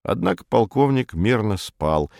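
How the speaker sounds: plain reading voice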